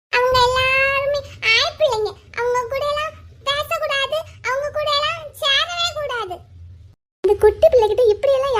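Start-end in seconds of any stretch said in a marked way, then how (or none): 0.1-6.4 put-on high-pitched little child laying down rules; disapproving small boy. Boy warning teacher about girls, disapproving child voice.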